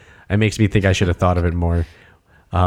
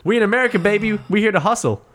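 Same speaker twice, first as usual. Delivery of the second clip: hype hustler; 12 semitones higher, much faster than usual. Pretend.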